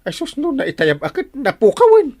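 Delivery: sudden high, loud panicked wail; panicked cry. Acted. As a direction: cry out in alarm over the lost papers. Captain panics over lost papers.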